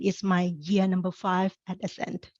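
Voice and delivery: ordinary delivery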